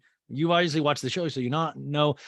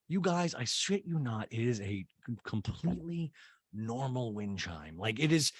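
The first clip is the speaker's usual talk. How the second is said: ordinary talk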